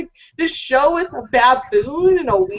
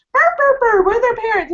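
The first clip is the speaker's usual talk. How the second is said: mock cartoon parents; 8 semitones higher than usual. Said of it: "And like".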